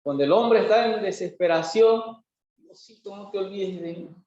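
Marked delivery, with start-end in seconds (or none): 2.6-4.2 softly voiced little prayer; acted small, quiet supplication; soft plea. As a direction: plead under your breath. Desperate man pleading to God.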